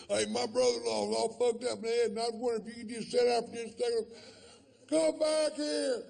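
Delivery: high, strained stranger rising to a shout; pushy stranger yelling. Retold or imitated. A pushy stranger yelling at Doc as he walks away. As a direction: badger him, then scream after him.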